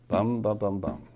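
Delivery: BUM, bum, bum, bum, opening beat hit hardest; stressed first beat. Shown as a demonstration. Teacher beats out the line, stressed first beat. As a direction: drum out Bum, bum, bum with weight up front. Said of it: "This is emphasized more than thou".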